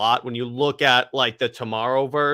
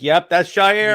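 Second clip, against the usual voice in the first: higher, louder delighted recognition; knowing recognition; 5 semitones higher, 5 dB louder than usual. Retold or imitated. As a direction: say it like you knew it. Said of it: "and I'm like".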